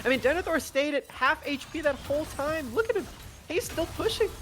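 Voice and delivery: high-pitched, incredulous caster voice; amazed excitement. Real, not acted. Caster marvelling at a player still pushing, amazed.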